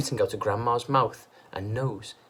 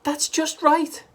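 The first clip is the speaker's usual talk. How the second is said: anxious plea; 7 dB louder, slower than usual. A narrator's reading. Wife pleading.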